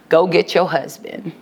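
low, measured acted order; deadpan command. Jesus's blunt command after long pause.

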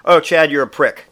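fed-up comeback, played for laughs; mock exasperated aunt. Aunt scolding Chad for teasing, mock exasperation. Aunt's annoyed comeback, acted.

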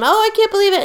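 high, excited disbelief; wife hugging husband back from the dead, high and overjoyed. Retold or imitated. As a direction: gush with relief at the reunion.

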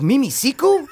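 high, puzzled movie-line echo; Tim Allen quote. Retold or imitated. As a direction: echo the name in a high questioning voice. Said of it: "And Tim Allen's like".

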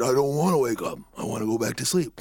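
put-on a rusty old king warming up; sleepy old king. Waking king talks to the boy.

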